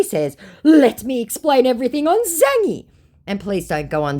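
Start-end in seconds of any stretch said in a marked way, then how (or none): 0.6-2.9 mocking scammer voice. Reading the scammer's reply.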